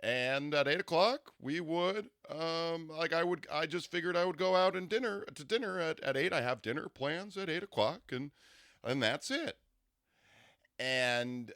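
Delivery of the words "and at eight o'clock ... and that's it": higher put-on voice with halting, deliberate cadence; Barack Obama. Imitating her date's dinner plans, measured formal impression. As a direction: imitate a careful speechmaker, phrase by phrase. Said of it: "like Barack Obama sounding".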